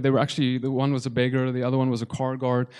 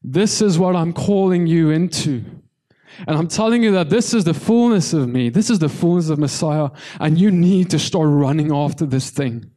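full-force preaching; 3 semitones higher, 4 dB louder than usual. Real, not acted. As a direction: preach it out with conviction.

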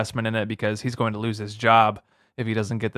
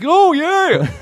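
much higher, louder hammed-up drawl; Doctor Phil voicing anaconda; 6 dB louder than usual. Cohost imagines Doctor Phil voicing the anacondas, high and loud. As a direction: squeal it like Doctor Phil as a lunging snake. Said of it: "if he voiced the anacondas".

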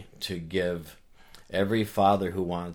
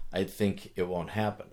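usual speaking voice

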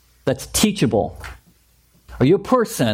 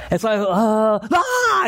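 mock outburst; 4 semitones higher, slower than usual. Blowing up in acted quarrel.

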